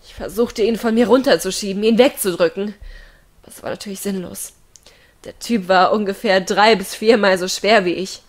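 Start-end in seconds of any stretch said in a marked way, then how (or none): 3.4-4.5 quick, softer whisper; hopeless whisper. A narrator's reading. Girl whispering that fighting him back is useless. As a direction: whisper it fast, giving up.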